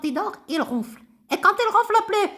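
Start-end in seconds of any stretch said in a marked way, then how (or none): 1.3-2.3 high, bouncy little puppet voice; high childlike puppet greeting the class. Acted. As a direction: play the cheeky puppet pupil. Ratoun puppet talking.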